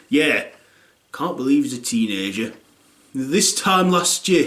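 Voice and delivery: ordinary delivery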